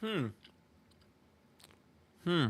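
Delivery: humming through a mouthful of food; mouth full. Real, not acted. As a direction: mouth full, chewing while answering. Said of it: "I don't wanna talk with my mouth full".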